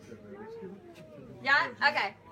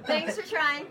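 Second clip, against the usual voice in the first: ordinary talk, nothing marked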